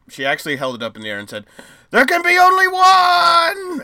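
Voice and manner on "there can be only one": slow booming battle cry; dramatic shout. Acted. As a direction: roar it like a movie hero. Epic movie line, lightning follows.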